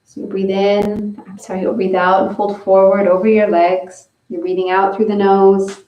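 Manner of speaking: usual speaking voice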